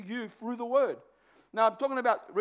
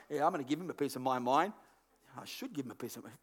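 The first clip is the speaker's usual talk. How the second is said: muttering resentful thought; 10 semitones lower, 9 dB quieter, faster than usual. Retold or imitated. Voicing the bad thought agreeing.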